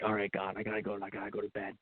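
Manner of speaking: sleepy whispered-down plea to God before bed; hushed prayer. Retold or imitated. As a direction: drop the voice low and private, talking to God. Tired late-night prayer to God.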